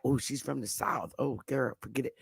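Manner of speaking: a quiet imitated put-down; dismissive aside. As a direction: toss it off like a dismissive gossip. Imagined dismissive Southern remark.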